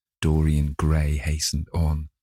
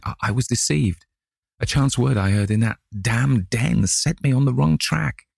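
shaken apology; 6 semitones higher than usual. A narrator's reading. James Vane apologising, shaken.

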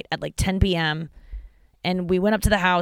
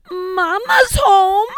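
high, slow drawn-out yell; 13 semitones higher, 9 dB louder, much slower than usual. Retold or imitated. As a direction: holler it out the car window. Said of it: "We just kept yelling".

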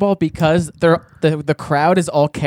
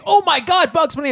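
hammed-up starstruck squeal, much higher; mock fan squeal; 11 semitones higher than usual. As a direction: gush like a starstruck kid. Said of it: "It wasn't like some fucking".

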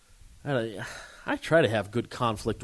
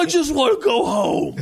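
put-on high, pleading drunk whine; almost crying, drunk; whiny drunk near tears; 14 semitones higher, 7 dB louder than usual. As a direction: drunk stranger begging to go home. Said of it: "almost, like, started crying".

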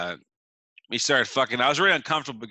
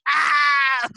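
high loud fake cry of pain; fake pain yelp; 12 semitones higher, 8 dB louder than usual. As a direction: shout out as if hurt. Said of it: "decided to be like".

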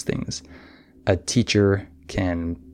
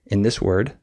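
usual speaking voice

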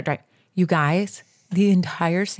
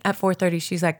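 normal talking voice

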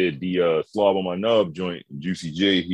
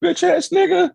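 high, loud mock cursing rant; imitated rant; 6 dB louder than usual. Retelling a friend going off, high loud mock rant.

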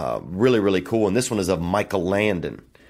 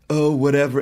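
mock posh British, pitched up and louder; lightly imitated British brush-off; British accent; 6 semitones higher, 4 dB louder than usual. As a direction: toss it off like a bored English gent. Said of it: "He's British as well".

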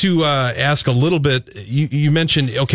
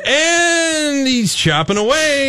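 high, drawn-out announcer call; excited play-by-play impression; Royce play-by-play; 11 semitones higher, slower than usual. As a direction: stretch the call out, pitched way up. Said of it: "doing your best Royce impersonation".

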